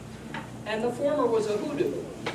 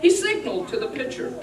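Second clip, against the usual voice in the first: ordinary delivery, nothing marked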